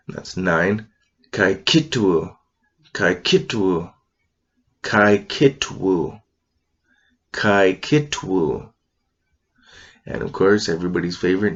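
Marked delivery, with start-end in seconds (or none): 1.3-8.7 Kaikitwu slow, glottal t before wu; careful teacher demo of Kaikitwu; Kootenai word. Shown as a demonstration. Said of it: "K I glottal T".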